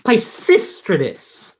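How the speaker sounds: slow, careful "Pisistratus" as pai-SIS-tra-tus; careful name practice. Shown as a demonstration. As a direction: sound out "Pisistratus" syllable by syllable.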